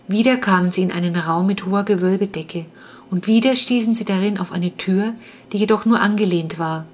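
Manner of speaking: ordinary delivery, nothing marked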